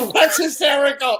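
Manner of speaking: high, over-the-top amusement; mock hysterics. Teasing Randy for laughing before the punchline, mock hysterics. Mimics Randy's reaction to joke opening.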